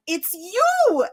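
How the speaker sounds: lightly voiced excited little girl. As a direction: play the wide-eyed little girl. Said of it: "she was like".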